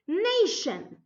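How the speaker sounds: "Nation" high then falling, sh brought out; clear SH sound. Shown as a demonstration. Teacher making students hear the sh, careful "Nation". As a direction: stress the first syllable of "Nation", sustain the sh. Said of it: "listen to the sh sound".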